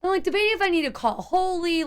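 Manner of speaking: ordinary delivery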